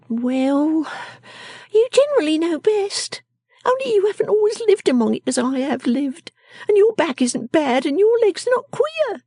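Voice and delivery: high, contrary character voice; quaint little shrew. Acted. Miss Jenny answering Lizzie, high and contrary. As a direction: concede grudgingly in a high little voice.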